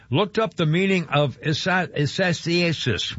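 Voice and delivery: Isasiasis spelled out very slowly, stumbling; slow, baffled try at Isasiasis; fumbling a name. Real, not acted. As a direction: grope slowly through Isasiasis. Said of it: "whatever the hell it is".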